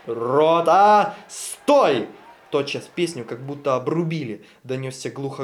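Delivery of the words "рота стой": loud barked command to halt; officer halting the singing company, loud command; army command. Acted. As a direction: bark the halt order. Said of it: "Вдали послышались глухо слова".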